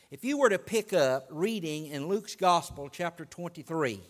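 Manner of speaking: ordinary talk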